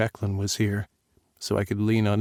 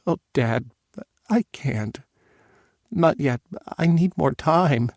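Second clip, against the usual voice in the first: tearful pleading; 6 semitones higher, slower than usual. Acted. Tearful daughter on phone.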